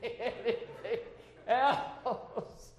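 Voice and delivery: words breaking into a laugh. Real, not acted. Preacher joking to congregation about coming too often, laughing. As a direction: laugh slowly through the words. Joking as God's imagined reply.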